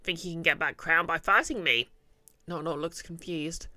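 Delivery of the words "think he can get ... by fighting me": troubled goblin. Acted. Goblin recalling how the king beat him, troubled and loud.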